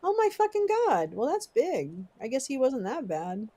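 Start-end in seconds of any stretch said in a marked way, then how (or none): none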